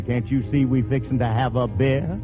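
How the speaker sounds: ordinary delivery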